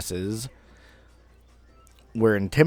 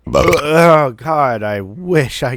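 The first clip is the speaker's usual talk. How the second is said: loud, exasperated oh God; a sudden loud groan of frustration; exasperated outburst; 5 semitones higher, 9 dB louder, slower than usual. Real, not acted. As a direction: blurt it out, fed up with yourself. Exasperated outburst about his feelings.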